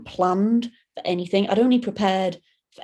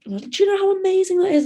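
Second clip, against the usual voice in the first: gushing local; 11 semitones higher, 3 dB louder than usual. Retold or imitated.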